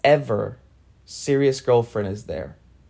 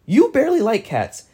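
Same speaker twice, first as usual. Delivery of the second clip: incredulous retort; 10 semitones higher, 4 dB louder than usual. Retold or imitated. Incredulous retort in retold dialogue.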